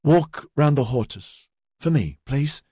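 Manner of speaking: slow, croaky and unsteady; croaky, tired from crying. Acted. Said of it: "with a croaky voice and unsteady long talk".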